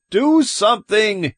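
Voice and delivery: high, loud frantic plea; desperate shout, pitch way up; screamed. A narrator's reading. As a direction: scream for them to act. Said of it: "he screamed".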